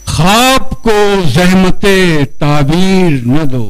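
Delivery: measured mushaira recital with drawn-out rhyme words; Urdu poetry recitation. Acted. Poet reciting his ghazal to a gathering, declamatory recitation. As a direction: declaim each line, let it fall away at the end.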